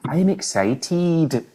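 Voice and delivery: "excited" slowed right down, Scottish accent, ending on a crisp teed; Scottish accent, drawing out the -ted of "excited". Shown as a demonstration. Telling cohost how Scots say excited, slow Scottish accent. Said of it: "if you say any word with, like, an E D on the end".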